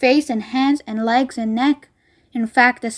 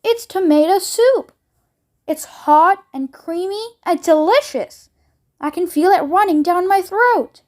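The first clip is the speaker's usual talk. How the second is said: excited; 6 semitones higher, 3 dB louder than usual. A narrator's reading. Girl thrilled by magic gum.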